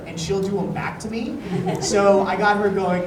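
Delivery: normal talking voice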